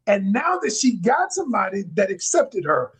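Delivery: normal talking voice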